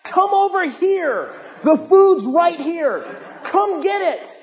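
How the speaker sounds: high-pitched drawn-out holler; yelling at ants. Acted. Preacher hollering at ants to come get food, yelling. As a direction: call out high and urgently, stretching the words. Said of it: "I'm yelling at them".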